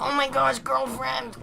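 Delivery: high squeezed cartoon voice; squished Player voice. Shown as a demonstration. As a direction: sound like he's being squished and manhandled. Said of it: "give it more of a quality, like he's being actively squished".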